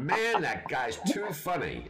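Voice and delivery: high, slow amused drawl, laughing; slow mock American. Retelling the ski-school guy loving Benny Hill, slow and laughing. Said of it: "And he went".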